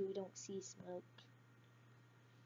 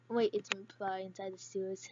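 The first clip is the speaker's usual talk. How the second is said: ordinary talk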